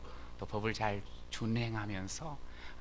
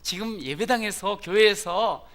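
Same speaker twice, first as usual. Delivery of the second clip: higher, loud, crisp confident answer; crisp and clear; 6 semitones higher, 6 dB louder than usual. Retold or imitated. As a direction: speak up brightly and clearly. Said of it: "또릿또릿하게".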